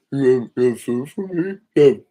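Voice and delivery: high, whiny pleading dog voice; voicing the dog. Dad voices his other dog begging for blueberries. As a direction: beg like a hungry pup, slow and high. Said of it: "He's like".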